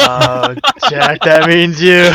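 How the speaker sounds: slow, loud laughing jab; laughing tease. Real, not acted. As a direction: tease him through laughter.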